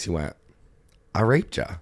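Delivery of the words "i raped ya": lightly imitated Southern twang; Southern US accent. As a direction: say it like a Southerner, understated. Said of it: "in a Southern voice".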